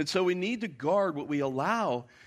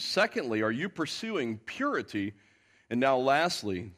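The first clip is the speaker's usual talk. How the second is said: ordinary talk, nothing marked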